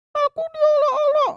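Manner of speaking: put-on very high child puppet voice, whiny and loud; a hurt little girl complaining; Susan puppet voice. Puppet girl Susan tells the kids she was teased, in a very high whiny voice. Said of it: "ini namanya Susan".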